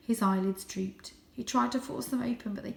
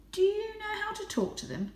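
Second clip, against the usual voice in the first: voiced high and light as a young cat; a proud little cat boasting; 14 semitones higher than usual. A narrator's reading. Young Varjak boasting his breed to the stray.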